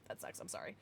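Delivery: soft whispered apology; whispered sympathy. Real, not acted. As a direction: breathe it out as a sympathetic aside. Consoling a teammate after a loss.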